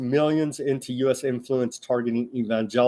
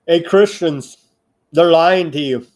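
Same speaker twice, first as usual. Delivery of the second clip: calling out; 3 semitones higher, 7 dB louder than usual. Real, not acted. Calling out to Christians.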